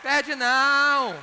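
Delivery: loud, long-held Mineiro assertion; drawn-out emphasis. Acted. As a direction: stretch it right out for the punchline. Emphatic punchline about Mineiro speech.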